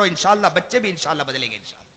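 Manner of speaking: rushed soft inshallah. Acted. Rushed soft prayer closing.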